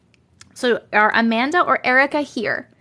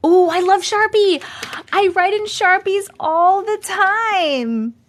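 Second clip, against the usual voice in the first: high, loud, sing-song delight; excited gushing; 8 semitones higher, 3 dB louder, slower than usual. Real, not acted. Streamer gushing to chat about Sharpies, excited. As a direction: gush it high, stretching the end.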